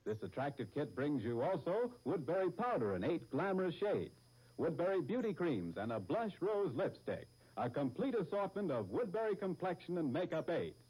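ordinary delivery

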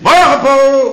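loud wake-up call to Paul; bright wake-up shout. Acted. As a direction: shout it across the room to wake him. Calling out to sleeping Paul.

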